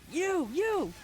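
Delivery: high, loud ranting comic impression; Orny Adams rant. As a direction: yell it like a fed-up comic on stage. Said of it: "He's like".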